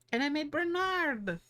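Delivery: high, doting coo; cutesy pride. Real, not acted. Streamer presenting the dog they modeled, cutesy pride. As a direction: coo it high, showing off your pet.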